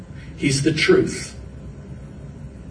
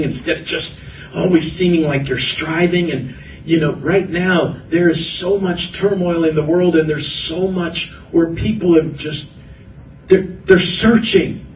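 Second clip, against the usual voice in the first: normal talking voice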